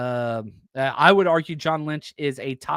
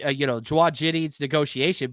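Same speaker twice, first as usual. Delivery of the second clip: ordinary talk, nothing marked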